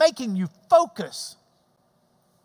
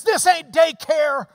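louder, higher, slowed coach taunt; coach's challenge; 6 semitones higher, 6 dB louder than usual. Retold or imitated. Preacher quoting the spin coach to the congregation, loud. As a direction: bark it like a tough spin coach. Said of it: "And then he says".

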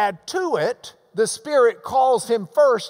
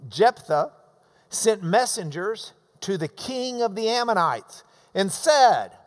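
normal talking voice

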